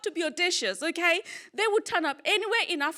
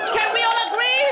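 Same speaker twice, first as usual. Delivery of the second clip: higher, louder crowd-rousing question; loud rallying call; 7 semitones higher, 6 dB louder than usual. Real, not acted. Comic asking the audience to agree, loud rallying call. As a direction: call it out to the whole room.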